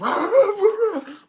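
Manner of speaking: high, loud frantic woofing; imitated dog barking. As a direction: bark like a big dog freaking out. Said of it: "my Great Pyrenees was like".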